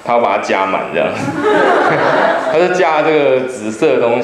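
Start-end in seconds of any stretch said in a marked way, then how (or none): 1.1-2.4 high breathy laugh falling away; airy high laugh that drops. Real, not acted. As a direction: burst out laughing at the memory. Laughs at refill story.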